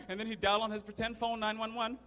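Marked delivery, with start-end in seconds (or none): none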